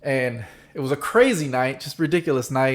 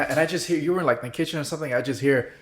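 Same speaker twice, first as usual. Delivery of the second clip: ordinary delivery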